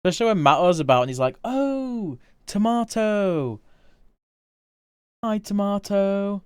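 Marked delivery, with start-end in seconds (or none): 1.4-6.4 high, drawn-out imitation in a slightly posh accent. Streamer mimics how Mato greets Tomato, slightly posh accent. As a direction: put on a slightly posh accent, higher and stretched out. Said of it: "my attempt at doing, like, a slightly posh accent".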